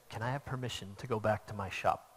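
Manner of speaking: soft voice. Retold or imitated. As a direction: ask it softly and humbly, voice dropped low. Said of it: "asked me in this soft voice".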